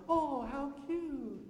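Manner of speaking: put-on slow, high cooing over a baby; cooing stranger. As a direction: gush like a stranger charmed by a newborn. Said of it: "people are like".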